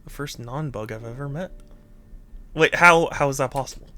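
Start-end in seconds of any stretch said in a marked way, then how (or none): 0.0-1.5 soft dialogue reading. Acted. Reading game dialogue aloud.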